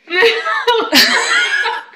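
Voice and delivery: loud giggling fit; high laugh. Real, not acted. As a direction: burst of high-pitched laughter. Laughing at own story.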